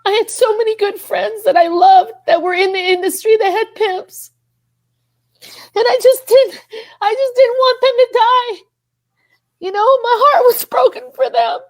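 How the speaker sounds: high, tearful voice, choked with feeling; real welling-up, pitch climbing far up; really emotional. Real, not acted. Grieving for friends left in the industry, overcome with emotion. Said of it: "I don't know why I'm getting so emotional".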